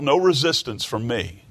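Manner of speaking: ordinary delivery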